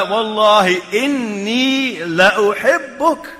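each word stretched slowly with weight; slow, heartfelt oath, leaning on "inni la uhibbuk"; drawn-out emphasis. Retold or imitated. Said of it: "look at the way he spoke to him".

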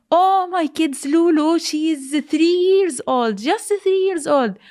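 high-pitched cry of a bereaved parent; retold screaming. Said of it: "still, still screaming".

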